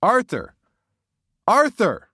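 loud, high-pitched yell; yelling a name. A narrator's reading. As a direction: shout out for a lost friend. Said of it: "I yelled out his name".

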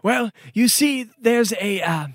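ordinary talk, nothing marked

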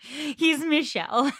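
slow words through real laughter; laughing. Real, not acted. Co-host laughing at her own joke comparing him to Michelle. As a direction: crack up as you say it.

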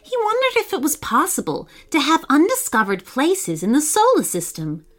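plain reading voice

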